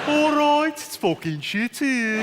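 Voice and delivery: high Brummie, slowing right down; exaggerated mock Birmingham accent with long vowels. Shown as a demonstration. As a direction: put on a high, dragging Brummie voice. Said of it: "do the Birmingham accent".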